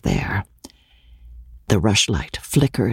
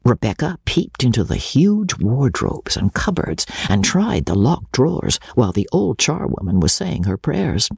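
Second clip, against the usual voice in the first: ordinary delivery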